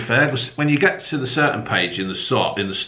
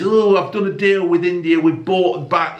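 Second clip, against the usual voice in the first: louder, higher smug politician impression; lightly mocking the prime minister boasting; mock Keir Starmer; 7 semitones higher, 3 dB louder than usual. Mocking the prime minister's trade deal, higher and louder. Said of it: "And he's".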